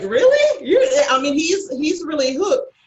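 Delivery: high, loud laughing cry of disbelief. Real, not acted. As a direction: burst out laughing at his question. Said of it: "I'm sitting there laughing".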